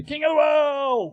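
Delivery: mock movie shout.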